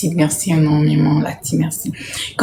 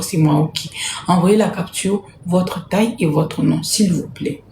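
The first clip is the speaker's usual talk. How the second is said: ordinary talk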